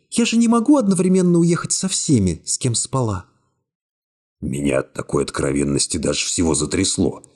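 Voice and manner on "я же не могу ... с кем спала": put-on lightly raised young woman voice, cool and calm; young woman's voice. A narrator's reading. Secretary coolly refusing the hero's embrace, high young woman voice. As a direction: voice her higher, calmly distant.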